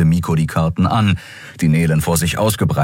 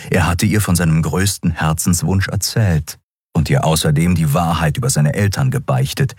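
ordinary delivery, nothing marked

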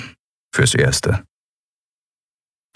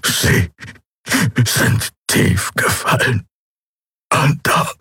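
pained; 7 semitones higher, slower than usual. Acted. Tortured prisoner speaks slowly.

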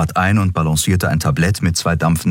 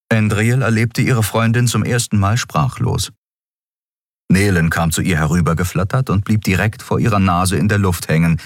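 ordinary delivery, nothing marked

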